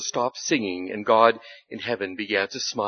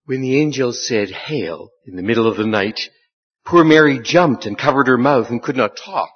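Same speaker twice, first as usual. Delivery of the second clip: lively storyteller reading to a congregation; story narration; 8 dB louder than usual. Acted. Preacher reading the annunciation story aloud to the congregation, storytelling voice. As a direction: tell the Christmas story with wonder.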